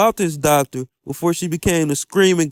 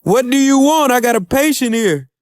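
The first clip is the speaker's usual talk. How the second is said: imitating a yell; 6 semitones higher than usual. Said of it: "she yells at him and says".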